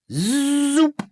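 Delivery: high, loud cartoon zoop; bright squeaky zoop noise; zoop sound effect. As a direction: make the machine go zoop.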